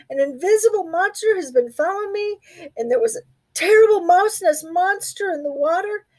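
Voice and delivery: high, fast frightened rush; breathless scared telling. Acted. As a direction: pour it all out, upset. Little Mouse tells his fears.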